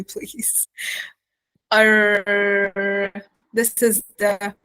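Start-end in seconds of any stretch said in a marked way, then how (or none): 1.7-3.1 long, sustained "R" with the tongue drawn back; drawn-out American R. Shown as a demonstration. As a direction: hold the r sound in "R" for repetition. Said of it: "repeat after me".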